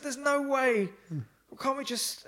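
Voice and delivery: high, loud begging; begging the man not to climb, high and whining; high pleading protest. Retold or imitated. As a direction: plead your way out of it.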